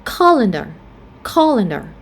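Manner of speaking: colander stressed on COL, falling; stress on first syllable. Shown as a demonstration. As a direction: say colander as COL-ander, middle vowel a schwa. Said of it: "we stress on the first syllable".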